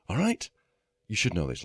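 ordinary talk, nothing marked

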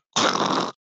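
voiceless rasping crunch noise; imitated motor damage noise. Game master imitates the boat motor getting shot, harsh voiceless noise. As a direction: make the sound of a motor being hit. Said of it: "and you hear, like".

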